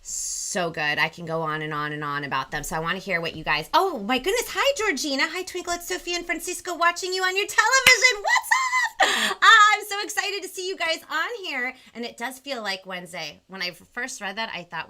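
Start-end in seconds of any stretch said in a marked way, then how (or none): 3.7-11.7 excited greeting. Real, not acted. Spotting viewers in the comments.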